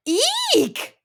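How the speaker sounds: very high, loud eek; three bears crying out in fright. Acted. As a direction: shriek it. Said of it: "cried the three bears".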